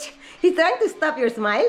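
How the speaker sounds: usual speaking voice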